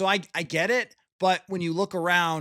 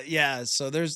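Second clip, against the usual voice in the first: ordinary talk, nothing marked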